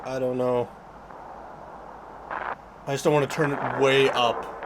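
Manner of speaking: normal talking voice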